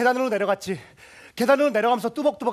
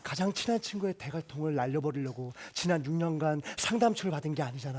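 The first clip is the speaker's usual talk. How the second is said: lower, softer, soothing; calm self-talk; 3 semitones lower, 4 dB quieter than usual. Acted. He calms himself down on the stairs, low and soft. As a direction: talk yourself down gently.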